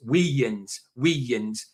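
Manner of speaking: "wee yins" with "ones" turned into "yins"; halfway contraction. Shown as a demonstration. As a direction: slowly show "wee yins" as two beats. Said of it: "say it kind of fast enough".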